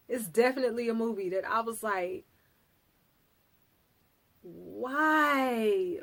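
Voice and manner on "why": long drawn-out, baffled "why"; exasperated why. Retold or imitated. Reacting to a boring movie, exasperated. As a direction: drag it out in dismay.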